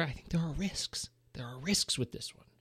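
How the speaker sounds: hushed, breathy warning; whisper. Real, not acted. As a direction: drop to a whisper for the catch. Hushed warning about sequel risks.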